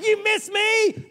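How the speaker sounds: very high, slow, shouted sing-song; childish taunt. Retold or imitated. A boy gloats to his friend that he dodged, high slow shout. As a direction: drag it out like a gloating kid. Kid arguing during play.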